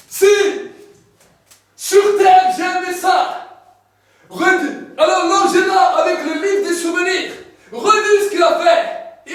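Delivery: loud, high booming voice; God proclaiming. Acted. God tells the angel to reread the deeds, booming. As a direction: command it grandly from the throne.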